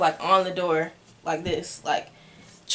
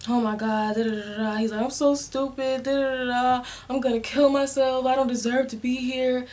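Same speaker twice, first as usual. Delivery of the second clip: imitating him, higher and tearful; mimicking crying boyfriend; 5 semitones higher than usual. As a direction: play his pitiful, weepy self-pity.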